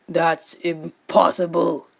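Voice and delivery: slow, gruff-free but weighty father; a distraught fish father; father's voice. Acted. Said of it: "cried her father".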